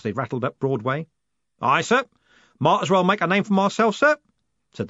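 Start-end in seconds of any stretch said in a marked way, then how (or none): 1.6-4.2 higher, louder rough coachman. A narrator's reading. Jim answering Moist, a lightly voiced coachman. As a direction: play the cheery driver.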